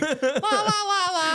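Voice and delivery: high, slow mock-carefree la-las; carefree la-la-ing. Girlfriend already down the way, blithe high la-las. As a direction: imitate someone blithely la-la-ing along, high.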